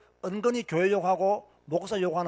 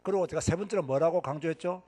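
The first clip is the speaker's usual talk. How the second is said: normal talking voice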